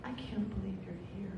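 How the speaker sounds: soft, quiet and heartfelt; tender. Retold or imitated. Nancy reaching her soulmate, soft and moved. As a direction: drop to a hushed, moved tone. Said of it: "And she gets to me".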